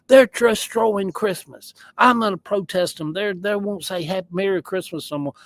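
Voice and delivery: lampoon the upset churchgoers; hammed-up panic over Christmas; mocking outrage. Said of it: "they were just like".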